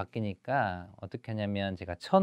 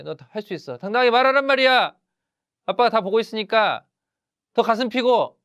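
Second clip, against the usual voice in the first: loud, high-pitched fatherly scolding; 10 semitones higher, 6 dB louder than usual. Acted. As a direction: urge your son on, raised voice, exasperated. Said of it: "그렇게 꾸중하지 마시고".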